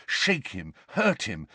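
plain reading voice

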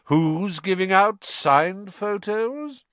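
high, slow, drawn-out question; grand inquiry. A narrator's reading. Lockhart asking who's signing photos, grandly calling out. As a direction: sing it out with relish.

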